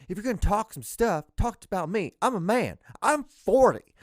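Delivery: put-on loud, high Southern-accented rant; Southern-accented shouting, climbing to a peak; Southern coach meltdown. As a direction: imitate a Southern coach blowing up at reporters. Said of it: "He has, like, the Southern accent".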